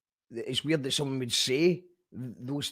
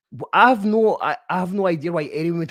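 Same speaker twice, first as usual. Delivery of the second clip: much louder, higher exclamation; burst of disbelief; 3 semitones higher, 7 dB louder than usual. Real, not acted. Host baffled at anti-Scottish voice argument, loud exclaim. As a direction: blurt it out in disbelief.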